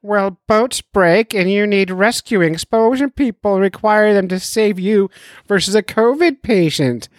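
a hint of a lecturing doctor. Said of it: "Sucker of Fun Gray says".

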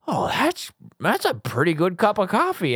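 high-pitched mock dad, pleased with his coffee; teasing impression of a satisfied father; imitating dad. Son imitating dad praising Folgers.

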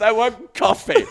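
high and loud, still laughing; real laughter on the punchline. Real, not acted. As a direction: choke the line out through laughter.

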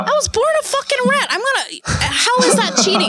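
very high-pitched, hammed-up outrage; indignant protest. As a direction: protest as if wronged, voice shooting way up. Worked-up defense of cheating.

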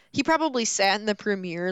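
ordinary talk, nothing marked

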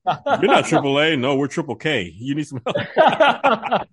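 slow, drawn-out menacing mock; hammed-up sinister offer of help; imagined roadside service turning sinister; mock Klan mechanic. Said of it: "They're like".

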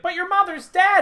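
high-pitched nagging wife voice; suspicious wife. Retold or imitated. Retelling the wife questioning her husband, suspicious. Acts wife challenging the lie.

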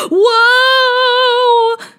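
high, loud startled shout of whoa. A narrator's reading. Girl sucked into the tablet portal, loud startled shout. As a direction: yell it out as you tumble through the portal. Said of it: "she said, spinning and turning".

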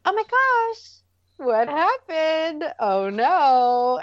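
a sing-song gasp to lighten the mess; light, high, amused surprise at spilled cereal; playful mock surprise. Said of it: "having just a little bit of humor".

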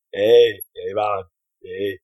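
put-on a light Jamaican accent on Arnie; easygoing Jamaican greeting, man. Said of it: "I'm going in Jamaican voice".

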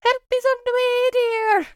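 high-pitched, sustained meme falsetto; Mrs. Doubtfire. Acted. Coworker quoting a film meme for someone needing help, high-pitched impression. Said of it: "the Doubtfire meme of".

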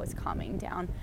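whispered aside. Real, not acted. Noting the goose settling, whispered. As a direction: breathe it out so the goose won't hear.

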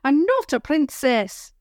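put-on high, defiant girl's voice; fierce little girl. A narrator's reading. Little girl snapping at her brother, fierce high voice. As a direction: stamp it out like a cross child. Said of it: "the girl said fiercely".

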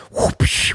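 unvoiced whoosh and crack of a whip; whip crack sound. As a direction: crack an imaginary whip with your mouth. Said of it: "with the whip and the vaqueros".

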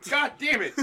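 hammed-up furious shout; mock fury. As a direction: explode in fake rage. Mock frustration at refusal.

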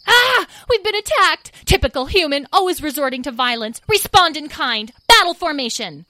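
high-pitched, indignant battle cry; alarmed fairies. Acted. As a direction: sound the alarm at being attacked.

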